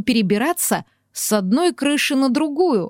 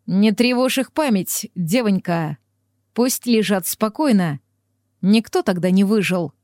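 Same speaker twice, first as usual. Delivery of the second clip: usual speaking voice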